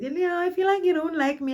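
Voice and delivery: put-on high, teary drunken complaint; imitating her drunk self whimpering; drunk whining. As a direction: whine it like a weepy drunk.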